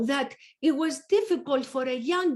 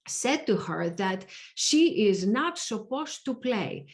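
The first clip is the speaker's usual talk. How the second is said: normal talking voice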